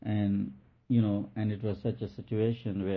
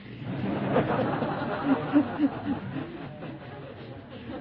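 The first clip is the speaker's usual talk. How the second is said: high laugh; 13 semitones higher than usual. Real, not acted. Laughs at the story.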